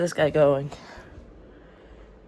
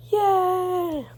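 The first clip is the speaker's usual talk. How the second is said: excited cheer. Real, not acted. Cheering about editing.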